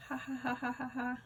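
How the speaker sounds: put-on jeering kids laughing; mocking laughter. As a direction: laugh at him softly in mockery.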